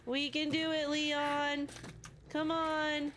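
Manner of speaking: drawn-out high plea; urging. Real, not acted. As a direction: cheer him on in a high, held voice.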